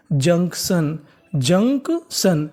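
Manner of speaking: Junction drawn out very slowly in two parts; syllable by syllable. Shown as a demonstration. Teacher showing students how junction is built, very slowly. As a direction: break junction into "junk" and "shun", stretching each. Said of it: "तो पूरी meaning क्या बनी".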